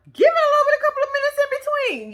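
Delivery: high, exasperated plea; exasperated urging. Pretend. Storyteller urging friend snooping in his bag, exasperated. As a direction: pitch it way up, urging her to wait.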